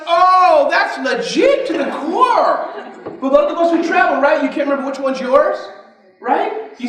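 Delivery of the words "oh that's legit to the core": high, loud, slow, laughing amazement; excited amazement. Retold or imitated. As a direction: gush over the clever tchotchke. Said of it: "I'm like".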